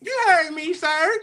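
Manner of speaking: high, put-on grandma scolding; old lady voice. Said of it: "my old lady voice".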